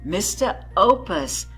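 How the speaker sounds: ordinary delivery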